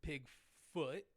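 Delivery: ordinary talk, nothing marked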